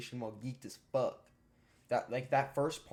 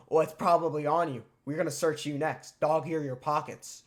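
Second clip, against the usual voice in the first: louder, higher, commanding; bossy order; 3 semitones higher, 7 dB louder than usual. Retold or imitated. School staff ordering a pocket search, loud and bossy. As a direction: bark the order down at him.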